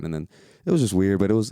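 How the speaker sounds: ordinary talk, nothing marked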